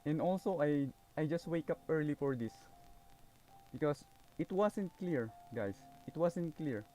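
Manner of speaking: usual speaking voice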